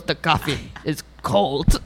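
flat foreign voice complaining to the server; imitating Voss. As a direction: state it with no politeness at all. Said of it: "he goes".

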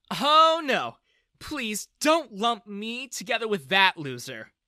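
put-on loud, high teenage-style objection, starting on a big "oh"; disgusted protest. A narrator's reading. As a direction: distance yourself from the loser. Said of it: "Bella made a face".

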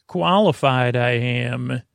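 normal talking voice

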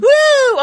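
high, loud "woo" whoop; crowd cheer. Pretend. Cheering the imagined solo show, high loud whoop. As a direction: whoop like an audience greeting the host.

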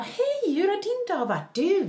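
high, eager chatter greeting her partner; mimicking herself bubbling over as her partner gets home; chatty self imitation. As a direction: gush at him the moment he walks in. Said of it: "när man kommer hem från jobbet och så bara".

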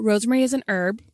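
"herb" with a silent h, as "erb"; American herb. Acted. Host explains name to American audience, "herb" said as "erb". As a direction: drop the h on "herb", American style. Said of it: "we're in America now".

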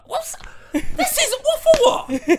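high, laughing disbelief; cracking up at how absurd it was. Real, not acted. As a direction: laugh it out in shock. Incredulous laugh at the bouncer.

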